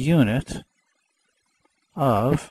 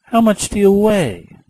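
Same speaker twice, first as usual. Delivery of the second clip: student voice; 8 semitones higher than usual. Retold or imitated. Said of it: "So you say".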